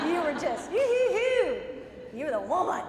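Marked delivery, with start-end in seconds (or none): 0.7-1.6 high, loud victory hoot; a playful boxer's victory cry; triumphant whoop. Pretend. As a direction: whoop like you're on top of the world. Acting out feeling triumphant.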